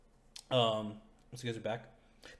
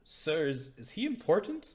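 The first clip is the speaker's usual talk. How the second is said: awed cultist; 7 semitones higher than usual. Acted. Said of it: "They're like".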